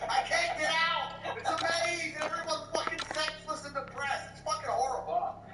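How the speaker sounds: high, drawn-out mock despair; exasperated complaint. Shopper trapped in depressing IKEA, theatrically exasperated. Acts out his misery in IKEA.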